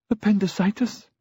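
high, tearful rising answer; acted grief, pitched right up; sobbing. A narrator's reading. Grieving friend naming the cause of death, tearful. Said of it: "Frieda was sobbing".

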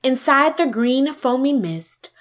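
usual speaking voice

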